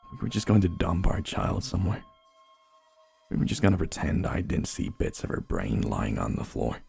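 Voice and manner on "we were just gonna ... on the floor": ordinary delivery, nothing marked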